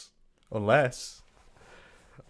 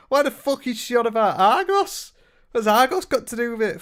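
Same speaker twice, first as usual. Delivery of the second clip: exasperated disbelief; 10 semitones higher, 3 dB louder than usual. Real, not acted. Baffled outburst after laughing.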